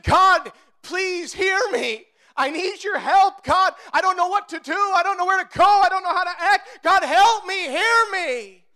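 high, loud desperate pleading; a preacher voicing a desperate prayer from the pit; crying out to God. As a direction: beg God from the bottom of the pit. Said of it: "we've cried out to God".

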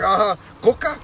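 loud, high doubtful question; skeptical mom. Retold or imitated. Mom doubts the soda excuse, skeptical. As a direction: mom sees right through him.

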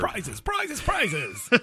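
high hyped game-show chant of prizes; booming prize announcer. Acted. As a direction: sell the prizes like a host.

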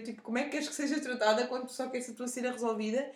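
normal talking voice

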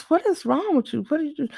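high-pitched exasperated questioning; prodding. Retold or imitated. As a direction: imitate a baffled passenger prodding the driver. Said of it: "because she kept prodding".

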